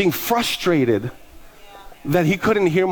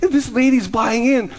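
amazed excitement; 8 semitones higher than usual. Retold or imitated. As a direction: marvel at it in a high, thrilled voice. Reenacts amazement that she bought in.

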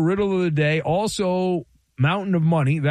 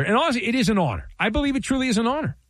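mock sincere; 7 semitones higher, much faster than usual. Mock praise of chant.

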